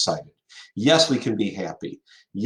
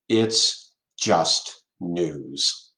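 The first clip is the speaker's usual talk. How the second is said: slow, deliberate catchphrase; 4 semitones lower, much slower than usual. Shown as a demonstration. Catchphrase drawn out slowly.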